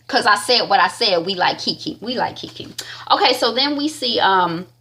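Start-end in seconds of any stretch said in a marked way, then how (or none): 2.0-2.7 soft, unvoiced whisper; whispered repeat. Real, not acted. Host repeating her approval to listeners, whispered.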